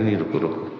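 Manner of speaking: soft, low shushing; mother softly telling the child to wait; hushed mother. Retold or imitated. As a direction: hold the child back quietly. Mother hushes child near guest.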